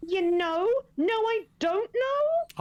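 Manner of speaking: high questioning rise, drawn out; slow, high, puzzled character voice. Acted. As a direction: stretch out the puzzled reply, voice climbing. Character questions the other's vague remark.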